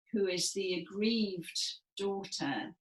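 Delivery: usual speaking voice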